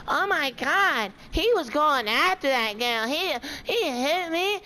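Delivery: high, slow whiny mock of the teacher. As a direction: mimic her high and whiny, drawn out. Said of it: "this little five three teacher going".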